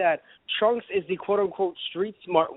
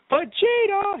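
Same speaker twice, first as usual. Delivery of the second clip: high mock yell of the name; calling out; 13 semitones higher, much slower than usual. Mom summoning Vegeta, high mock call. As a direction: shout the name across the house.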